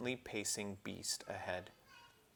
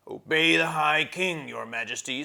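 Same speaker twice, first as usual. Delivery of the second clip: grumbling dwarf; 7 semitones higher, 10 dB louder than usual. Acted. Trumpkin urging the children on, loud grumpy dwarf. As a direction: bark it like a tired, cross dwarf.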